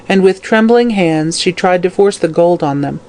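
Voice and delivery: ordinary delivery, nothing marked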